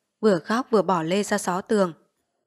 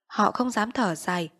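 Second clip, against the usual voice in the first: usual speaking voice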